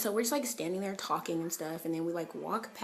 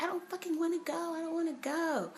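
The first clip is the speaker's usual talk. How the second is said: high, put-on whine; whiny refusal; 12 semitones higher than usual. Irritated driver refusing to go on, whiny. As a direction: whine it like a sulky kid. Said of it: "I'm like".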